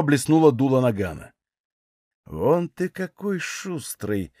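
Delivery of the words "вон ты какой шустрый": very slow, soft, silky menace; insinuating. A narrator's reading. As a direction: draw it out softly and slyly. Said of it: "вкрадчиво проговорил Григорий". (вкрадчиво, тихо и медленно)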